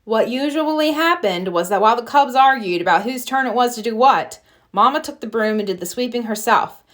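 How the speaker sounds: usual speaking voice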